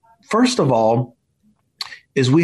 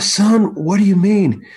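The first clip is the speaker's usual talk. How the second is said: higher-pitched, puzzled dad; bewildered questioning; 7 semitones higher than usual. Retold or imitated. Dad questioning injured son, high bewildered disbelief. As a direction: replay disbelief with the pitch lifted.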